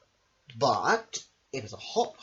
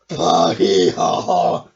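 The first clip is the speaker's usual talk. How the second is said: much lower, loud, slow honking bray; motor horn honk; 7 semitones lower, 17 dB louder, much slower than usual. Acted. Missus Armitage honks the motor horn at the big wave. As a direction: blare it like an old horn. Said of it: "went Missus Armitage on the motor horn".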